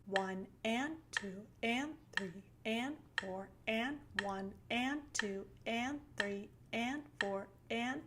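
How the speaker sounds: each "and" punched, numbers light; stress on the offbeats. Shown as a demonstration. Teacher shows syncopation by stressing every "and". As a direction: lean on every "and" in a slow count. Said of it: "emphasize the notes in between the beats".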